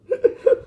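short high-pitched laugh; a quick squeaky giggle. Real, not acted. Laughs after joke. (cười ré lên ngắn)

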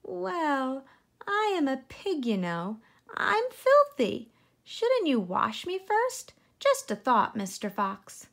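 high, coaxing little pig voice; sweet piglet making a suggestion; crafty piglet. Acted. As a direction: talk the fox out of eating you. Piglet voice in story.

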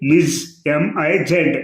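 slow మిస్ with a final z buzz, then spelled out; Ms said as miz. Shown as a demonstration. Teacher answering how Ms is read, said slowly as miz. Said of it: "ఎంఎస్ అంటే ఎలా పలకాలి?".

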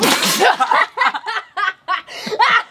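high wheezy laugh at own pun; corny dad laugh. Real, not acted. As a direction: giggle hard at your own joke.

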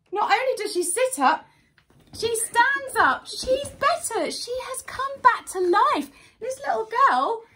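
thrilled reveal of the miracle; excited storytelling. A narrator's reading. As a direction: build wonder for the children.

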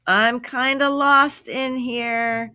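high, drawn-out call from far inside; lost and hollering for the others; very loud voice. A narrator's reading. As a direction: call out slowly to be found. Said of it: "came a very loud voice".

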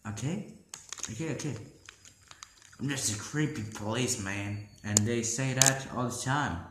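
lightly voiced creepy monster cop; creepy police officer.

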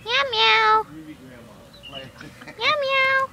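high double mew; imitated cat meow. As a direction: mimic a kitten in paired meows. Meowing at the cat.

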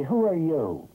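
mildly indignant duck; rather cross. A narrator's reading. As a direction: challenge a stranger a little crossly. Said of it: "Quack said rather crossly".

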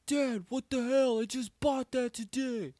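high, drawn-out mock teen protest; acted outraged protest. Son to dad over smashed vape, high outraged protest. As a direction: protest in high-pitched disbelief.

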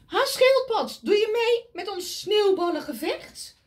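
high, loud playful squirrel; high, loud squirrel calling; eager squirrel. Acted. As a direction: invite him like a cheeky squirrel. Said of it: "Vraagt Eekhoorn".

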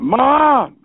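loud high call for Mom. Retold or imitated. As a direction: shout "Mom!" across the house. Kid calls mom excitedly.

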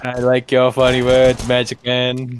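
low-pitched presidential impression; JFK. Riffing on being called a terrible JFK, low impression. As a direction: put on a low, stately voice. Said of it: "that's just a terrible J F K".

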